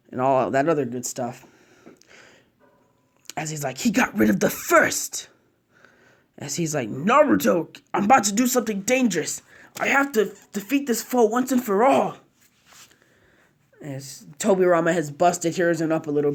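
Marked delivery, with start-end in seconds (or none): 3.8-12.2 solemn higher-pitched character voice; urgent farewell. Acted. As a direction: lift the voice high, a hero saying goodbye. Hashirama's farewell to Naruto.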